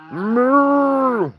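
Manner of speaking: high, loud drawn-out moo at the cows; mock cow moo. Calling out to the cows with a high, loud moo.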